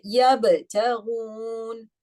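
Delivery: yabtaghun slow, ba cut off hard with a bounce; stopped ba. Shown as a demonstration. Teacher recites to class, stopping the ba. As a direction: clip the ba in yabtaghun. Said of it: "I cannot let the sound run".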